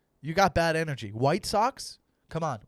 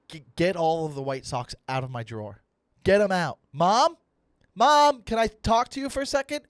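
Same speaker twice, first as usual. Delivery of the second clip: put-on loud, bossy kid ordering his mom, building higher; demanding teenager; 4 semitones higher, 6 dB louder than usual. Fourteen-year-old ordering mom to clear his drawer, demanding. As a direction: call out to Mom, imperious. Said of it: "that's how I spoke to my mom".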